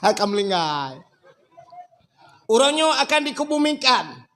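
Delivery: high, loud mosque announcement; over a PA system. Retold or imitated. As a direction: call it out like a loudspeaker notice. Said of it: "Bengah ku pegah pengumuman li ku imum".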